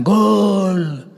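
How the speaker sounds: high, loud fan cry; mock goal shout. Sports fans celebrating at a match, shouting. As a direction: yell it like a football crowd. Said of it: "si gritan".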